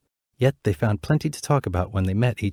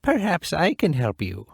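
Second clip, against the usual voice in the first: put-on higher, kindly old woman; lightly voiced old woman; 8 semitones higher than usual. Old woman offering help to the princess, higher. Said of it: "said the old woman".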